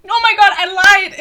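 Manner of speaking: loud, high burst of surprise; real startled realisation. Real, not acted. Reviewer finds notes disprove her point, startled outburst. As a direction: blurt it out, caught in your own mistake. Catches own mistake in the notes.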